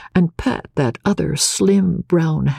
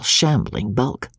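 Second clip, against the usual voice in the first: plain reading voice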